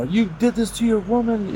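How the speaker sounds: high-pitched reproachful woman's voice; imitated Russian woman. Russian woman rebuking him over his girlfriend, imitated in a high voice. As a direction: imitate her scolding, pitched well up. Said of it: "the Russian woman was like".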